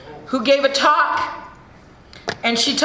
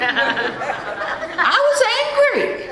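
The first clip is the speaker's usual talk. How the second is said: a real laugh, then slow words through it; drawn out slowly while laughing; laughing admission; 6 semitones higher, much slower than usual. Real, not acted. Admitting to the room she was angry, laughing slowly.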